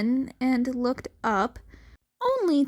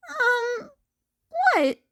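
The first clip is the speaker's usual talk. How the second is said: put-on high boyish voice; 11 semitones higher than usual. Izuku startled.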